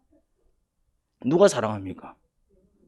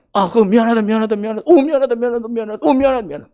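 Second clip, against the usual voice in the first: high, acted flurry of apologies; frantic apologising; 6 semitones higher, 3 dB louder than usual. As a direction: fuss anxiously over a child, pitched high. Acts parent frantically apologising to child.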